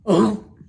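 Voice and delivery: loud grunting oh as he lands; a pig's thumped grunt; winded grunt on landing. A narrator's reading. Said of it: "he grunted".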